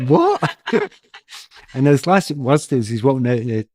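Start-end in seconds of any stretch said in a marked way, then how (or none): 0.1-0.9 much higher, louder startled what; high incredulous what in mock disbelief; mock surprise. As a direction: yelp it in comic shock. Reacting to burglar's openness.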